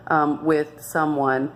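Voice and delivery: ordinary delivery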